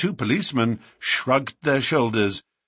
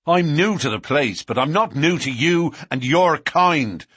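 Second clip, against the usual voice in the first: loud, higher, hard and furious; cold fury; 6 semitones higher, 5 dB louder than usual. A narrator's reading. McMurdo flings the policeman's phrase back, loud with cold fury. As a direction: throw his words back at him in cold fury. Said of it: "cried McMurdo in cold fury".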